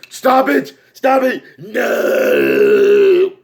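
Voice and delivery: high frantic protest dropping to a growled no; whining clown. Acted. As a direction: beg shrieking, then drag out the no. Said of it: "And he's like".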